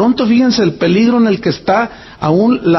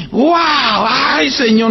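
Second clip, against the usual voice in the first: awed exclamation; 6 semitones higher, much slower than usual. Retold or imitated. Marvelling praise.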